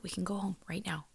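urgent whisper. Retold or imitated. Friend urging her to go home, whispered. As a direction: whisper the offer to leave. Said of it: "And my friend was like".

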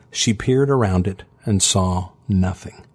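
ordinary delivery, nothing marked

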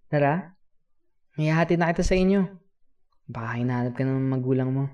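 put-on rally the child to head home; friendly kuya. Offers to walk child home.

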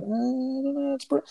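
high, hesitant stammering put-on voice; high, halting, mock-reluctant voice; mock whiny self. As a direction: play a reluctant viewer dodging British TV. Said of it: "And I'm like".

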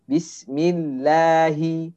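Bismillahi said slowly with the la kept light and thin. Shown as a demonstration. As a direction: stretch Bismillahi, keep the vowels thin. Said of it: "Harusnya kita tipiskan bismillahi".

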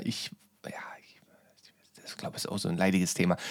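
hushed fast whisper. Real, not acted. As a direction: drop to a whisper. Hushed aside in voice message.

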